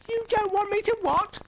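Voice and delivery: very high, loud panicked squeal; panicking. Acted. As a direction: shriek the question in panic.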